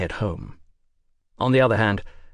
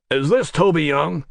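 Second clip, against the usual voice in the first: American producer voice; 5 semitones higher, 5 dB louder than usual. Acted. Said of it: "work a lot harder on that American accent".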